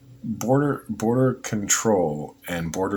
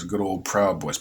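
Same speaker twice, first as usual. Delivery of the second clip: ordinary delivery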